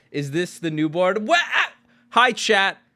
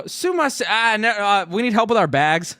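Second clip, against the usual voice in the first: high, hammed-up Japanese accent, louder toward the end; retelling how he greeted the Uber driver, in a put-on Japanese accent; jokey Japanese-accented English; mock Japanese accent; 5 semitones higher, 5 dB louder than usual. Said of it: "I'm fully in on the Japanese accent".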